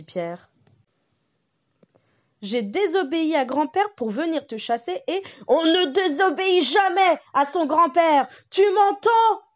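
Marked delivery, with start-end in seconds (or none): 2.4-9.4 higher, louder mock roar; yelling. The wolf yells at the boy Pierre, higher and louder. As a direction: shout it in his biggest voice. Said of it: "Hurle Lucas de sa plus grosse voix".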